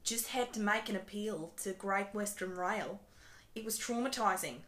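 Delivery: reading a tweet in Australian; Australian-accented complaint. Acted. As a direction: put on an Aussie accent, building to indignation. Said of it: "Uh, Australian!".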